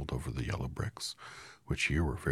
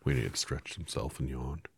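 ordinary delivery, nothing marked

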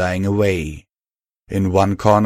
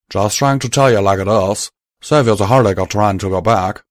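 loud, firm Southern cowboy, sorry underneath; 5 dB louder than usual. Acted. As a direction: break the news plainly, with regret. Calamity, steady drawl.

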